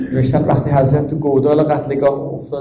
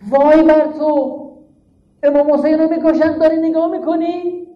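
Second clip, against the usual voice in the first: higher cry from Zainab to Omar Saad; Zainab's cry; 6 semitones higher than usual. Retold or imitated. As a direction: wail it high as a sister rebuking a killer. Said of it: "فریاد زد".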